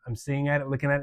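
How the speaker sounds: ordinary delivery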